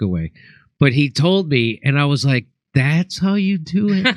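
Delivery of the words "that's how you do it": slow, higher-pitched mock incredulity; let-down disbelief. Comic retelling his reaction on learning the trick's secret, slow disappointed disbelief. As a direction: draw it out as an appalled question.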